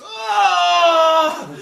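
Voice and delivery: huge high roar, half crying; mock battle roar. Mocking Ron Perlman's roar after the birth. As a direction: bellow it like a warrior howling. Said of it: "And he goes".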